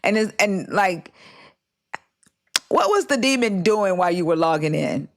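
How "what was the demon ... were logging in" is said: ordinary talk, nothing marked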